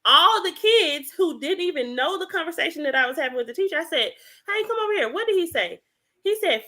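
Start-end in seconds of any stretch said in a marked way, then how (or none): 4.5-5.8 calling out. Retold or imitated. Calling kids over.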